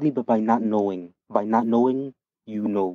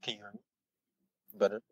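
barely audible; 11 dB quieter, much faster than usual. Real, not acted. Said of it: "It's a little bit better".

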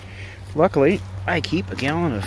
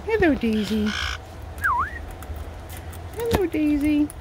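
high-pitched hello to a pet; pet-greeting voice; 12 semitones higher, 3 dB quieter than usual. Real, not acted. Owner greeting Daisy, high pet-greeting voice. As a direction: lift the whole greeting high, higher on the repeat.

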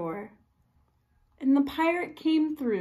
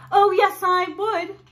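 higher, louder insistence; insistent; 7 semitones higher, 8 dB louder than usual. Acted. Fierce woman insisting to pirate, loud. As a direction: stamp your foot on it. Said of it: "said the fierce little woman".

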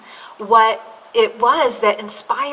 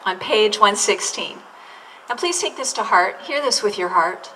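ordinary talk, nothing marked

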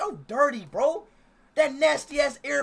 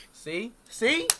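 usual speaking voice

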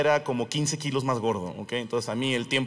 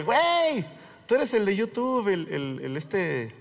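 excited recognition; 6 semitones higher, slower than usual. Retold or imitated. Old classmate recognizing the YouTube comedian, excited. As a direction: hail a half-remembered celebrity, starting way up high. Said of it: "me dice".

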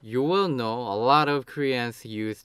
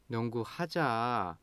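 pleading; 6 dB quieter, faster than usual. Shown as a demonstration. Asking an uninterested friend to play basketball, pleading. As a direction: beg quietly, stretching the last syllable. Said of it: "Now you are pleading".

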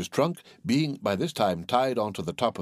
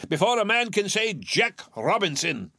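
high, hearty urging; jovial old master; 8 semitones higher than usual. A narrator's reading. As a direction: hurry the lads along merrily. Fezziwig merrily hurries the lads.

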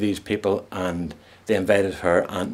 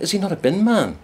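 high, incredulous question; lightly voiced friend, baffled; puzzled surprise; 10 semitones higher than usual. Shirley asking Rosemary in disbelief, pitched up high.

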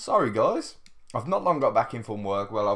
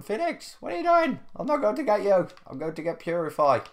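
much higher playful whine; mock squeaky protest; 9 semitones higher, 3 dB louder, faster than usual. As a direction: tease in a squeaky put-on voice. Teasing player in game.

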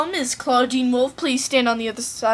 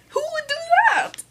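much higher, faux-innocent question; mock innocent; 14 semitones higher, faster than usual. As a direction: play falsely innocent.

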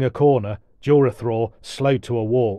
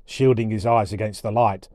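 usual speaking voice

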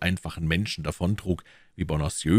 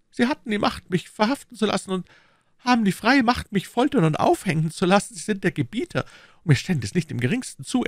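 higher, louder nervous protest; anxious Bonacieux; 3 dB louder than usual. Acted. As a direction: plead his innocence. (ängstlicher Bonacieux)

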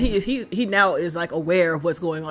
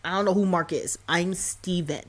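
ordinary talk

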